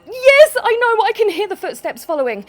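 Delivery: high, loud burst of alarm; panicked. Real, not acted. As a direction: answer loudly, rattled by footsteps behind. Panicking at footsteps behind while leaving.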